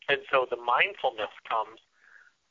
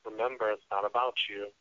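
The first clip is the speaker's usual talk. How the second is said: soft, calming self-talk; quiet, compassionate reminder to yourself; mindful inner voice; 4 dB quieter than usual. Acted. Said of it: "say with my mindfulness".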